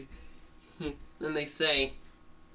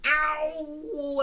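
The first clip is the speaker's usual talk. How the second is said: long, high cartoon ow; hammed-up sustained cry of pain; pained howl; 14 semitones higher than usual. Dog punched hard in the chest, pained howl. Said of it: "punches dog in the chest really hard".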